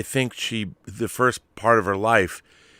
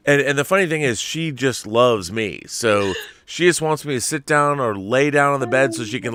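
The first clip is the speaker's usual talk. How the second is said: ordinary talk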